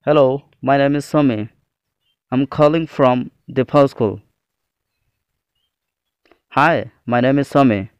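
ordinary talk, nothing marked

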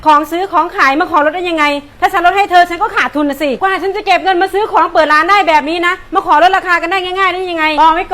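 loud, scolding rant; angry lecture. Acted. Shop owner ranting at a customer who asked for a discount, loud scolding.

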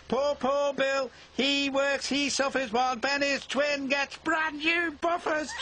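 very high, squeaky mocking chant; teasing trucks. A narrator's reading. Troublesome trucks jeer at Bill, high-pitched teasing. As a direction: taunt like mischievous little creatures. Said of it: "spotted a chance for a tease".

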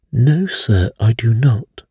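a forceful, emphatic no. A narrator's reading. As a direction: flatly deny it with conviction. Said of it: "declared the doctor emphatically".

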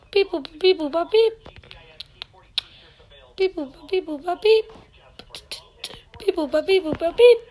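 high-pitched machine beeps; playful beeping noises. Pretend. Player faking a task, high playful beeps. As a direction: chirp little button noises, well above normal pitch. Voicing button presses.

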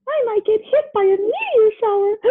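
high-pitched, fretful worry with a gasp; hammed-up frightened little voice; scared. A narrator's reading. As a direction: squeal it timidly, then gasp. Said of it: "Uranus was too scared".

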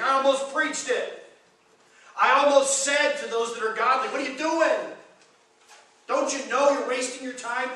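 loud, high, incredulous questioning; preacher acting out an exasperated outburst; exasperated challenge. Acting out psalmist challenging the godly.

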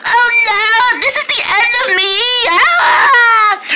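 high frantic cry of doom; panicked cry. Acted. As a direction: cry out as if it's the end. Character being beaten in fight.

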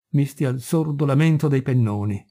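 usual speaking voice

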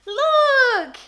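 high, loud, squealy bird-calling; excited pet coaxing. Real, not acted. Owner calling the bird to see the award, excited and high. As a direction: coax a pet over in an eager high voice.

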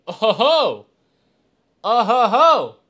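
loud, high chuckling oh ho ho; delighted laugh. Real, not acted. Streamer laughing at his new glasses to chat, delighted.

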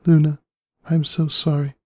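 high, crying-out apology to a lost sister; anguished apology. A narrator's reading. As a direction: let it break out high, full of grief. Celestia breaks down, blinking tears.

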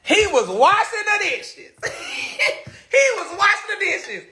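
high-pitched, words squeezed out between laughs; helpless laughing. Real, not acted. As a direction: try to say it through a laughing fit. Laughing while retelling.

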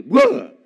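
sudden loud "whoa" burst; startling yell. Acted. Madman jumping out at tomb visitors, startling yell. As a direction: leap out with a short mock-monster yell.

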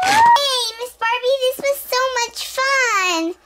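put-on excited child. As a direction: gush with delight. Child thrilled after ride.

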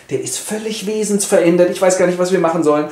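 ordinary talk

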